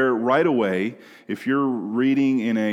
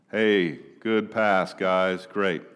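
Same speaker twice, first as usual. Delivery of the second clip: slow, low, unenthused praise; half-hearted cheering; 4 semitones lower, slower than usual. Acted. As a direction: cheer lazily for a game that barely matters. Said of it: "that's not the way we talk".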